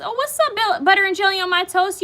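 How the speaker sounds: high, fast, bright question; delighted surprise. Shown as a demonstration. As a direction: greet the name like an old friend. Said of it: "I gotta say it like that".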